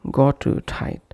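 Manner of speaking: GOT TIGHT with an "oo" between; extra vowel inserted. Shown as a demonstration. Teacher demonstrating a wrong pronunciation, extra vowel inserted. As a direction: put an "oo" after "got", as in "gotu tight". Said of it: "avoid bringing any other sound between".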